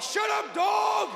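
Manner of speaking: high, angry holler out the window; yell. Acted. Neighbor yelling out the window, angry shout. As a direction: yell it across at the neighbor's dog. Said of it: "yell at the neighbor's dog".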